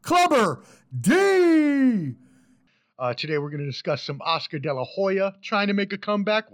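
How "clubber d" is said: drawn out, slow and loud; host announcing his guest like a boxer; ring announcer. Acted. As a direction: stretch the name like a fight announcer.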